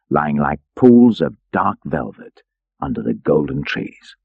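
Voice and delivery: ordinary delivery, nothing marked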